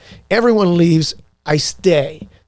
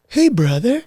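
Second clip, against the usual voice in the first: high, easy surfer-dude greeting; laid-back surfer bro hello; California surfer dude; 8 semitones higher than usual. Retold or imitated. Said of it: "Like, total California surfer dude".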